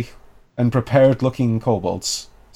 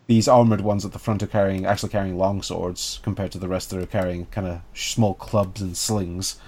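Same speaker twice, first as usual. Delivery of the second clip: ordinary talk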